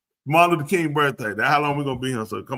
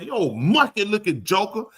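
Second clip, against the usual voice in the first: higher, slow mock taunt; acted insult; 7 semitones higher than usual. Host demonstrates calling them names, drawn-out mock insult. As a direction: stretch out the insult as if calling someone out. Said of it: "when you say it, you call them".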